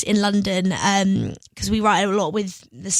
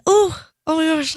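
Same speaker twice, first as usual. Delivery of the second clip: higher-pitched retold gasp of surprise; startled; 6 semitones higher than usual. Singer retells someone jumping behind the door, startled. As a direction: imitate being caught off guard.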